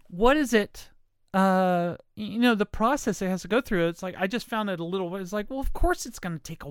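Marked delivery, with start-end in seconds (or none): none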